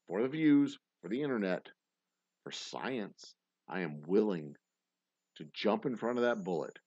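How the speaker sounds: ordinary delivery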